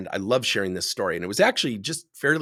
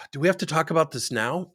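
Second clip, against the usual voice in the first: sighing, higher-pitched reluctant boss; impatient sigh; 5 semitones higher than usual. Acted. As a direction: heave a sigh, then ask as if bothered. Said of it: "look at my watch and I go".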